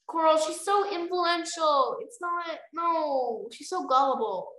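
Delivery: slow, drawn-out mock dismay; mocking disbelief. Reader scoffing at the gullible queen, slow and mocking. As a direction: stretch each word out in scorn.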